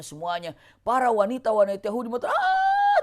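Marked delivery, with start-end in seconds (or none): 2.3-3.0 high shrieking "Ah" cry of grieving women; shrill mourning scream; women's scream. Retold or imitated. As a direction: let out a sudden high wail. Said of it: "teriak-teriak gitu".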